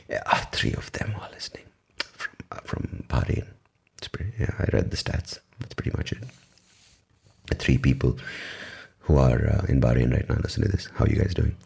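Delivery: whispered aside; hushed conspiratorial murmur. Real, not acted. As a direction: whisper it like a secret to a few listeners. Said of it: "whispered aside to Bahrain listeners".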